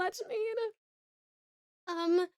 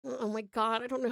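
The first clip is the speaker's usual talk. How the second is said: ordinary talk, nothing marked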